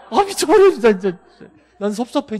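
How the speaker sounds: high, loud laughing outburst; exasperated cry. Real, not acted. Exasperated teacher laughing loudly. (답답해서 웃으며 크게 외치기)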